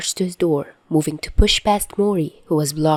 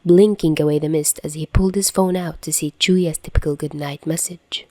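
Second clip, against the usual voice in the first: plain reading voice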